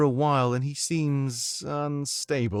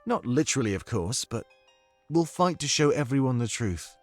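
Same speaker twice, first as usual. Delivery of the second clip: put-on soft British accent, non-rhotic and measured; Domik's comforting accent; 2 semitones lower, 4 dB quieter, slower than usual. Domik confides in the monarch about the Triumvirate, comforting British accent. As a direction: reflective British-accented companion, phrase by phrase. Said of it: "I need your comforting accent right now".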